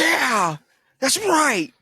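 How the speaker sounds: high, loud mock cheering; hyped-up agreement. Imitating how a friend would react, high and hyped. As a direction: play someone loudly, eagerly agreeing. Acts out imagined gleeful agreement.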